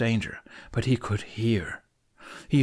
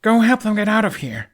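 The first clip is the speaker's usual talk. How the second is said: much higher and louder order; urgent shout; 12 semitones higher, 8 dB louder than usual. A narrator's reading. Hiccup sending the twins to help, urgent shout. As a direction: push them off with your voice.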